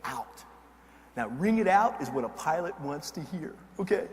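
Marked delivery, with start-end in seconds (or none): 0.0-0.5 low, quiet boss voice; a lightly voiced commanding officer; boss's order. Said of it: "and he goes".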